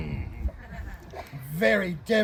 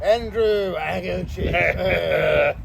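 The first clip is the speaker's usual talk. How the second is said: grand drawn-out signature; 4 dB louder, much slower than usual. Acted. Grand signature ending the letter.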